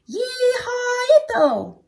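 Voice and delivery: slow high whooping holler. Acted. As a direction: stretch out a wild cowboy yee-haw, way up high. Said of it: "hollered Skipito".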